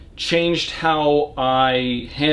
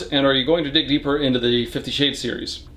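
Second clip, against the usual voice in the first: ordinary talk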